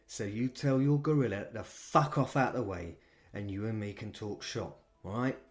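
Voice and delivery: put-on soft, defiant London accent; London manager. Manager to the vampire, quiet defiant London accent. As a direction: unafraid London gangster telling him off. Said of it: "He had a London accent".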